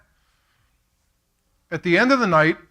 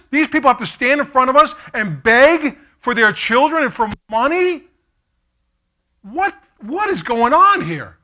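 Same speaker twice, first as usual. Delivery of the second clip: higher, louder outraged rant; 7 semitones higher, 7 dB louder than usual. Retold or imitated. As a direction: demand answers in disbelief, nearly shouting. Outraged question to his buddy.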